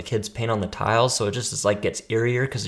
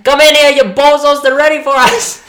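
put-on high, very loud yell in New York accent; shouted Brooklyn-accent summons; full-out yell; 14 dB louder than usual. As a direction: holler to people outside.

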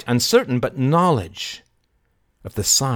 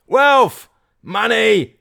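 high, loud crowd chant; crying out; 12 semitones higher, 8 dB louder, much slower than usual. Acted. Crowd clamouring for money, high loud cries. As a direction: call out each word like a clamouring mob. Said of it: "cried the crowd".

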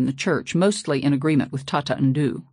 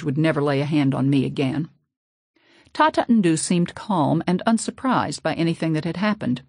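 ordinary delivery

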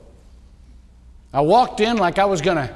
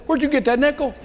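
higher, pointed mother's question; suspicious mother; 8 semitones higher than usual. Retold or imitated. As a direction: ask it like a mom who knows. Voicing his suspicious mother.

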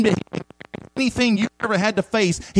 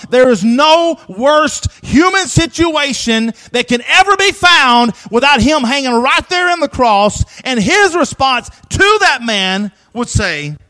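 fiery preaching; 3 semitones higher, 4 dB louder, slower than usual. Acted. Preaching at full force.

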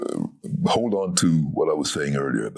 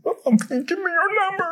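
mock lovestruck; faster than usual. Hopeful thought about Marilyn.

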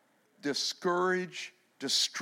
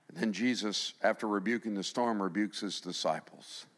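usual speaking voice

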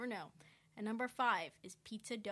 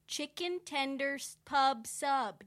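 louder, slow, selling hard; hyped ad pitch; 5 semitones higher, 7 dB louder, slower than usual. Shown as a demonstration. Host pitching Publix subs to Messi, loud and slow. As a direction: announce it like the star product. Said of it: "for this ad".

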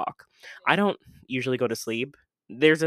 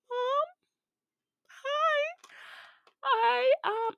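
very high, tiny, sheepish voice calling Mom; sheepish little voice; much slower than usual. Retold or imitated. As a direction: call for Mom in a tiny pleading voice. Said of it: "I have to be like".

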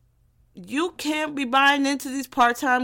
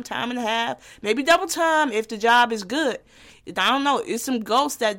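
ordinary talk

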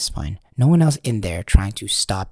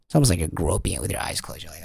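usual speaking voice

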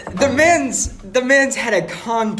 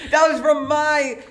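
indignant shout; 8 semitones higher, slower than usual. Real, not acted. Cohost steals his podcast topic.

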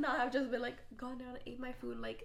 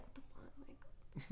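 whispered; 14 dB quieter, much slower than usual. Retold or imitated. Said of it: "I'm like".

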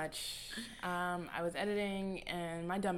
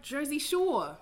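slow, louder Jersey Shore accent; 7 semitones higher, 8 dB louder, slower than usual. Shown as a demonstration. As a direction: show off a drawn-out Jersey accent. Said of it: "What's the Jersey one?".

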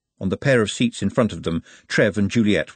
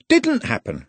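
higher, breezy and cheerful; 10 semitones higher, slower than usual. A narrator's reading. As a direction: wave it off happily. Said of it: "said the man cheerfully".

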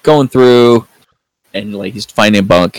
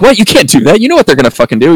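higher-pitched imitation of incredulous objection; mock protest; 9 semitones higher, 4 dB louder, faster than usual. Crew objects to Amos going to shower, mock incredulous protest. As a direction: lightly act out a startled protest.

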